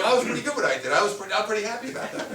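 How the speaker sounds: imitating him, chuckling and pleased with himself; retold happy laugh. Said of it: "At the end, he was like".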